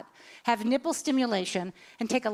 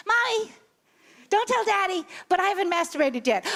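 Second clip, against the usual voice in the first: put-on higher boyish voice, pleading; twelve-year-old son; 6 semitones higher than usual. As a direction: play the little boy confessing to mom. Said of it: "what I hear my twelve-year-old son say".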